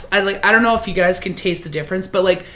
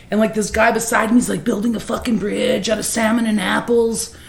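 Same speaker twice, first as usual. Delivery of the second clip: normal talking voice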